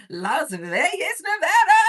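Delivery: laughing, rising to a high loud whoop; excited hometown shout. Real, not acted. Livestreamer telling viewers where she is, excited laughing shout. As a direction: build to a jubilant high shout. Proudly announces her city.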